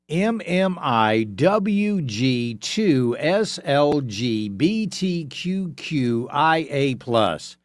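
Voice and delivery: letter by letter, drawn out; slow spelled-out recital. Retold or imitated. Host spelling out the new acronym for listeners, slow and deliberate. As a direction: spell it slowly, each letter on its own. Slowly reading out the long acronym.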